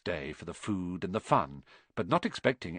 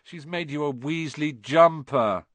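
louder, higher, drawn-out complaint; groaning dismay; 4 semitones higher, 5 dB louder than usual. A narrator's reading. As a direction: wail it in embarrassed dismay. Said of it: "he groaned".

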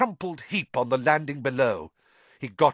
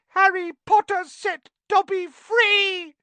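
shrill joyful house elf; 8 dB louder than usual. Acted. Dobby overjoyed.

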